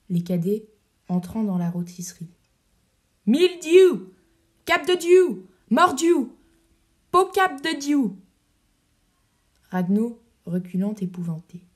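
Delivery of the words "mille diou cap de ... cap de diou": loud, high, swaggering shouts; rowdy Gascon oaths. Acted. Cadets storming into the shop, shouting oaths. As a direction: bellow the oaths like a pack of soldiers.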